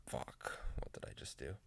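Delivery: whispered under her breath in dismay; voiceless whisper of instant regret; panicked whisper. Retold or imitated. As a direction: hush it like a horrified thought. Her panicked thought after bleaching.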